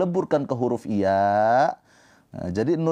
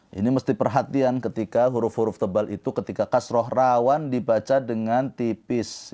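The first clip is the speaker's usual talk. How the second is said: ordinary talk, nothing marked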